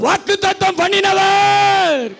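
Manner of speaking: high shout with a long-held last word; as loud as possible. Real, not acted. Preacher leading congregation's call and response, as loud as possible. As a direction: shout the call out, stretching its ending. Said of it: "இன்னொரு முறை சத்தமா சொல்லிட்டு".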